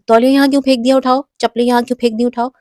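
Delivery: fast, loud, irritated scolding; scolding nag. Shown as a demonstration. Mother snapping at her child over the towel, fast and loud. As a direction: rattle it off like a fed-up mother. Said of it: "ایسے نہیں بولیں".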